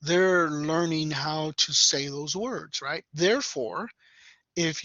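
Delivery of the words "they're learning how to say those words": ordinary delivery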